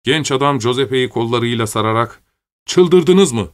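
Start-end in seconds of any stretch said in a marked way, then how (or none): 2.7-3.5 louder, higher-pitched protest; narrator's raised-voice shout; outraged cry. A narrator's reading. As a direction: cry out at an attacking crowd. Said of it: "diye haykırdı".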